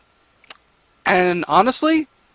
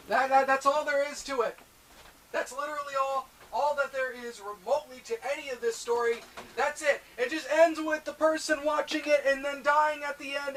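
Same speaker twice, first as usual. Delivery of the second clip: much softer, resigned muttering; deflated and quiet; 5 semitones higher, 14 dB quieter than usual. Real, not acted. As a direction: let the air out, barely audible.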